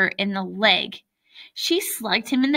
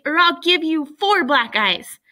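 angry threat; 7 semitones higher, 4 dB louder than usual. A narrator's reading. Acted sibling threat in story.

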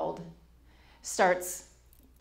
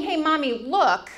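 put-on casual toddler call; 7 semitones higher, 5 dB louder than usual. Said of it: "Now he's not saying".

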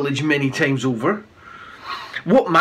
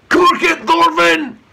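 loud booming shout, much higher; 12 semitones higher, 6 dB louder, slower than usual. A narrator's reading. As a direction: shut him up with a roar. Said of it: "boomed Eric".

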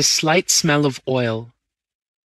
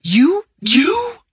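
much higher and louder, aghast; horrified gasp; 4 dB louder than usual. A narrator's reading. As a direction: gasp the word in horror. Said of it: "I gasped".

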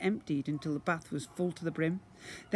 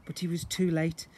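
plain reading voice

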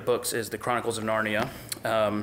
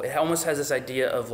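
ordinary talk

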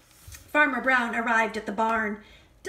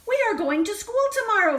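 higher, fast and louder, bursting with news; excited announcement; 8 semitones higher, 4 dB louder, much faster than usual. Acted. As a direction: blurt it out to the chickens. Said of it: "told the chickens".

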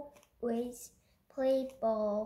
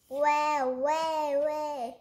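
wah wah wah, higher and louder; baby crying; 4 semitones higher, 4 dB louder, slower than usual. A narrator's reading. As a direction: cry like a baby.

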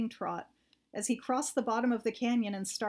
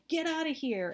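higher-pitched yell into the dark; 6 semitones higher than usual. A narrator's reading. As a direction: shout to scare off an animal. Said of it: "and yelled".

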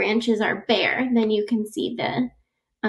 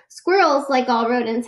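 ordinary delivery, nothing marked

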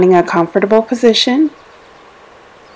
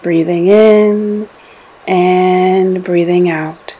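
slow, drawn-out words held to a breath; slow breath pacing; slower than usual. Acted. Meditation guide pacing listeners' breathing in and out, slow and drawn out. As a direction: stretch each cue to match a long breath.

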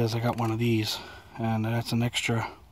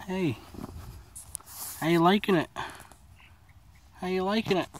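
high pet talk; 7 semitones higher than usual. Real, not acted. Talking sweetly to the chickens.

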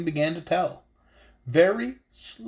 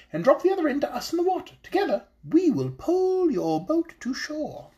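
high, small voice of a wise little fish; high fairy-tale fish voice, earnest; magical fish voice; 10 semitones higher than usual. Acted. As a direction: speak up as a tiny enchanted fish, solemn and hopeful. Goldfish gives instructions.

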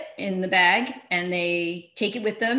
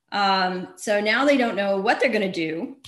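ordinary talk

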